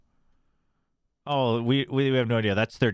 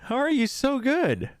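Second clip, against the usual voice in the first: amazed; 13 semitones higher, slower than usual. Real, not acted. Amazed at chat's guess.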